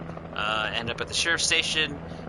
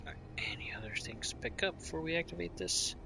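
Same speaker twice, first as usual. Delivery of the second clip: whisper; 5 semitones higher, 9 dB quieter, faster than usual. Real, not acted. Whispering to himself.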